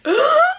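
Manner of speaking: sharp high gasp of excitement; a quick thrilled intake of breath; excited gasp. Real, not acted. Sudden realisation of progress.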